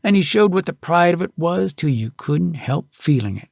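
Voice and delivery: ordinary delivery, nothing marked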